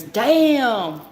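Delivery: a sudden loud, high "Damn!"; startled exclamation. Retold or imitated. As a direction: blurt it out like a startled bystander. Guy startled by the crash.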